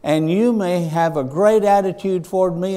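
full-force pulpit delivery; preaching. Acted. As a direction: preach it out to the congregation.

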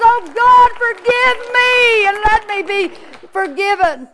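high, loud cry to God; preacher acting an anguished prayer; desperate plea. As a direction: plead for mercy at full voice, easing at the end. Acted plea for forgiveness.

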